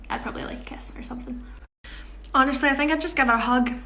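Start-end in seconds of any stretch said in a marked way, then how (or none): 0.1-1.4 whisper. Real, not acted. Shy aside after joke.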